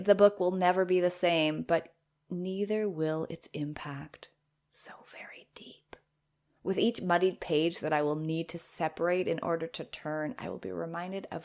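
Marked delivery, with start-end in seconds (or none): 4.7-6.0 whispered sarcasm. Real, not acted. Whispered ironic aside.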